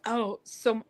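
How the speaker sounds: ordinary talk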